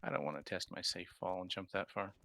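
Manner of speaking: ordinary delivery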